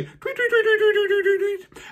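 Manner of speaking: very high chirping tweets; high bird tweets. A narrator's reading. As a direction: chirp it like a flock taking off. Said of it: "They flew away".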